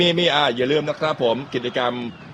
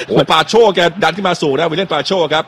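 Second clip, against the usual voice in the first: excited commentary; 5 semitones higher, 6 dB louder than usual. Real, not acted. Live play-by-play attack builds.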